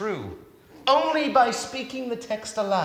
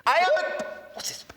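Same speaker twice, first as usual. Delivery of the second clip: squeaky comic voice; 12 semitones higher, slower than usual. Acted.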